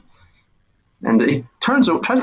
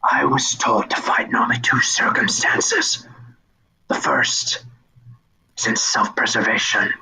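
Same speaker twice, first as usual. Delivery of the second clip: whispering figure. Acted. Figure calmly whispering its rules to the boy. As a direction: whisper it like a patient doom.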